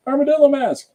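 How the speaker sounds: high, loud sudden cry; mock scare shout. Crafter acting the zine's armadillo scare, mock shout. Acts scare line from own zine.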